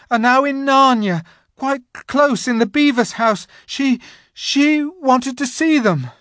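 high, loud nervous babble; trembling. A narrator's reading. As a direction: rush it out in fear. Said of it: "trembling so that he could hardly speak".

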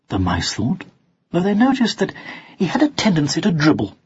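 ordinary delivery

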